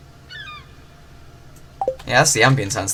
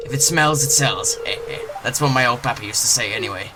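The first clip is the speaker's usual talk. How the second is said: little pirate voice. Acted. Gamer voicing the fisherman greeting the newcomer as a pirate. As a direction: growl it out like a friendly old pirate. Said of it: "Little pirate voice".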